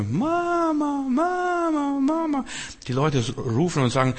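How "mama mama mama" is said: viel höher, weinerliches Kinderrufen; schreiendes Kind. Retold or imitated. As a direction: wie ein verlorenes Kind nach der Mutter rufen. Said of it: "dann schreit ein Junge". (hohe Kinderstimme, die nach Mama ruft)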